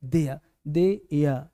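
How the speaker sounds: slow, low "देयर" said as "देया"; correct DEYA. Shown as a demonstration. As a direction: drop the r from each "देयर", drawn out. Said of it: "दो बिंदी देंगे". (धीमे "देया, देया", र के बिना)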